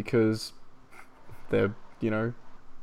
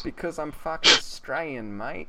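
mock-defiant Aussie accent, slightly higher; exaggerated Australian; 4 semitones higher than usual. Answering why the voice is dumb, broad Australian accent.

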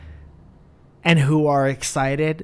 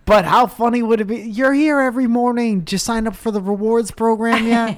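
cheery shop worker; 7 semitones higher than usual. Acted.

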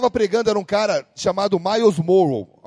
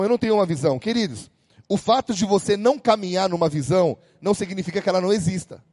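ordinary talk, nothing marked

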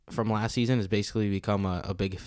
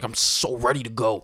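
hyped-up Tim. Acted. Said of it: "Tim drinks a cup of tea and is like".

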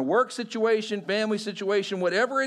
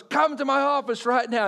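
higher-pitched, lightly mocked angry boss; frustrated boss; 5 semitones higher than usual. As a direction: summon an employee as an irked boss, half imitated. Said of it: "so frustrated and so angry".

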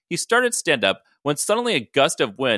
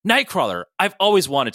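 excited yell; 7 semitones higher, 5 dB louder than usual. Acted. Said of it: "Ryan yelled".

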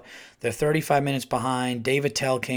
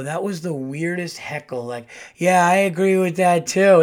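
building louder and higher, mocking; mock roast; 4 semitones higher, 7 dB louder than usual. Comic roasting the heckler on stage, building louder. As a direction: crank it up as you pile on.

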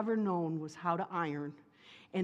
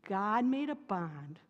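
usual speaking voice

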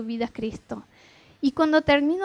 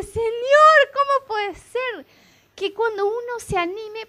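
high, loud, amazed call of Señor; an astonished prayer, slowing on the question; awed cry to God; 8 semitones higher, 5 dB louder, slower than usual. Real, not acted. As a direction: lift it up to heaven in wonder. Crying out to God in awe.

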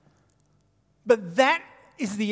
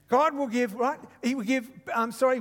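ordinary delivery

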